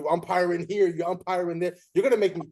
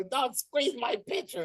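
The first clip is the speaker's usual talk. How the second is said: slow, softer put-on coach voice; mock coach grumble; 8 semitones higher, 4 dB quieter, slower than usual.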